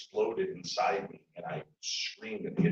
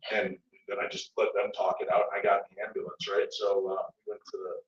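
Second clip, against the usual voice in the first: ordinary delivery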